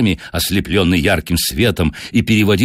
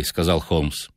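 ordinary delivery, nothing marked

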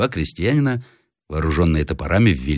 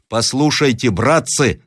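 mildly raised, higher-pitched appeal; crying out; 6 semitones higher, slower than usual. A narrator's reading. Captive appealing to the peasants, crying out mildly. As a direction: call out to win their attention. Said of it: "вскричал Рославлев".

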